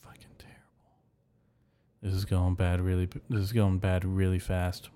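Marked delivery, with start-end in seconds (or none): 0.0-0.9 under-the-breath whispered curse; a private grumble whispered aside. Real, not acted. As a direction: hiss it to yourself, barely voiced. Muttering to himself on stream.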